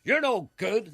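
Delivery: high, loud mock boss firing; hammed-up boardroom boss firing someone; mock Alan Sugar. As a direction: bark it like a TV tycoon.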